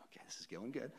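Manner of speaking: hushed private whisper to himself; whispered aside. Retold or imitated. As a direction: whisper it as a secret thought. Aside to himself within the story.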